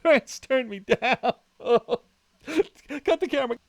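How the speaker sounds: high, loud words through laughter; cracking up, barely getting words out; laughing loudly. Real, not acted. Streamer to chat after France's refusal, laughing hard and loud.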